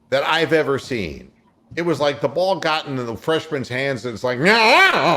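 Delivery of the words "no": mock dismay. Voicing dismay at the shot.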